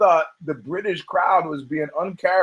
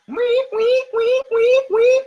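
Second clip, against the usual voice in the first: very high mock alarm beeps; high squeaky mock alarm; siren beeping. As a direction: chirp it like a warning siren. Alarm noise imitation.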